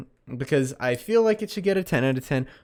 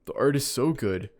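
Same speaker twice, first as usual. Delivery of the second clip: fan voice; 3 dB louder, slower than usual. Retold or imitated. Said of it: "all of you are gonna be like".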